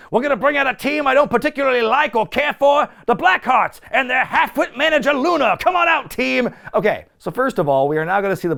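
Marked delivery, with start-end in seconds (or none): 0.1-6.5 higher hammed-up announcer voice; bury the team with mock gusto; Albano impression. Imitating Albano introducing the Blackhearts on his segment, high hammed voice. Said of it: "He goes".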